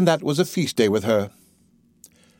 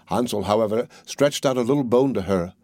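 ordinary delivery, nothing marked

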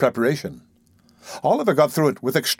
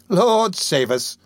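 startled, a touch higher, slow and drawn out; startled exclamation; 6 semitones higher, much slower than usual. Acted. Old lady startled by the boy's eerie words, slow startled exclamation. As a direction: gasp it out as if caught off guard. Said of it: "exclaimed the old lady, starting".

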